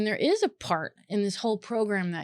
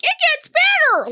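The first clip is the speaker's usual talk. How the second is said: much higher, sing-song cheery Pollyanna voice; mock Pollyanna; 10 dB louder than usual. Mocking empty hope-talk, high chirpy Pollyanna voice. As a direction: parody a relentlessly upbeat optimist. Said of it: "I don't mean to be like Pollyanna over here".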